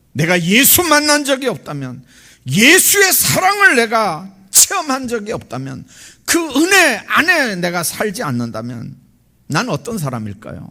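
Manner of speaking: loud, high-pitched impassioned preaching; forceful preaching. Real, not acted. Preacher asking who he'd be without Jesus, forceful preaching. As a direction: hammer each condition home from the pulpit.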